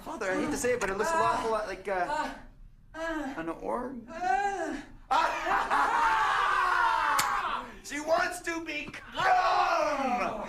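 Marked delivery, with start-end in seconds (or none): 4.0-7.8 high, loud burst of laughter; a big shouted laugh. Acted. 7.8-10.4 stretched out very slowly through laughter, high and loud; yelling the guess mid-laugh; laughing shout. Acted.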